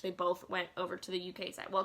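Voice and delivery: normal talking voice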